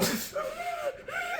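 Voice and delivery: high shrieking burst of laughter; a high, wild laughing shriek. Real, not acted. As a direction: laugh so hard it almost sounds like crying. Recalling the painful prank.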